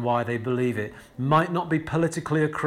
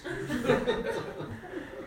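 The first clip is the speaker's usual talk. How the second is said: real breathy laugh; 9 semitones higher, 8 dB quieter than usual. Real, not acted. Preacher laughs again.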